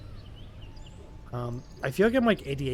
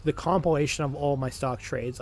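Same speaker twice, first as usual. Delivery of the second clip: normal talking voice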